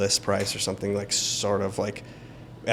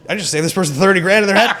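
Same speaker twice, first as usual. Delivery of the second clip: higher, louder and fast, thrilled; excited fast boast to yourself; triumphant; 8 semitones higher, 9 dB louder, faster than usual. Retold or imitated. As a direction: blurt it out in triumph. Triumphant inner thought.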